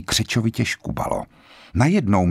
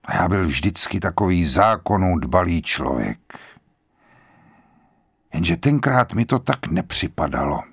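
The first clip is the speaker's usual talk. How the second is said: lightly voiced grave general; old general, calm and grave; 4 semitones lower than usual. A narrator's reading. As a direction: speak like a resigned old man at peace.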